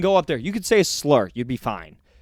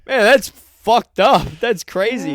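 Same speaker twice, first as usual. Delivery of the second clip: slow, stunned disbelief, pitched up; shocked reaction; 6 semitones higher, 6 dB louder, slower than usual. Retold or imitated. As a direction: react like you just heard something awful. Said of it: "I remember being like".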